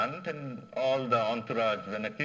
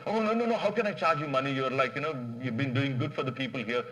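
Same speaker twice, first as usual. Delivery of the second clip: excited refusal; 3 semitones higher, 5 dB louder, faster than usual. Retold or imitated. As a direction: wave the money away, loud and eager. Farmer refuses money, excited.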